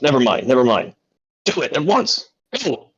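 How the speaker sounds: fed-up boss giving orders; exasperated command. Acted. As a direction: send the hapless apprentice off impatiently. Acted boss gives up, orders apprentice off.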